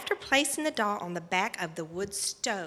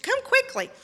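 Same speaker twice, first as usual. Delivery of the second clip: high, loud, hurrying shout; urgent call; 7 dB louder than usual. Retold or imitated. Mom summoning daughter to see the doll, urgent. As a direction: call someone down at once.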